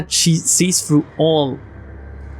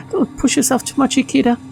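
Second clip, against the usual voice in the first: put-on higher girl's voice, stuttering as she taunts; stammering teasing girl; 8 semitones higher than usual. Neneko mocking Ikeda as a scaredy cat, high and stuttering. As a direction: taunt him while tripping over every word.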